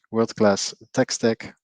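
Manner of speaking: usual speaking voice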